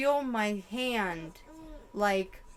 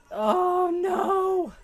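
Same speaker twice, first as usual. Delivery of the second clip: slow high horrified oh no; dread; 9 semitones higher, 7 dB louder than usual. Real, not acted. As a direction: stretch it out in horror. Dread realization.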